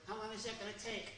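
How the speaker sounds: fast, quiet puppet voice; impatient little sock. Acted.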